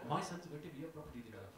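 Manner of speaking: fast, lightly posh proud mum; middle-class mum. Retold or imitated. Mum boasting about her son, quick light middle-class accent. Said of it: "she has got a middle-class accent".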